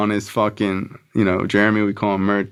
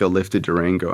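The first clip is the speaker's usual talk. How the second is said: ordinary delivery, nothing marked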